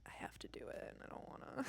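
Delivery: soft sulky whisper; reluctant whisper. Real, not acted. Streamer admitting her therapist is right, reluctant whisper. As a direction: whisper it like you don't want it true.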